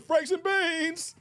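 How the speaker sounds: high, slow, drawn-out squeal; squealed movie quote. Retold or imitated. Streamer gleefully quoting the movie line, drawn-out squeal. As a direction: stretch it out high and delighted.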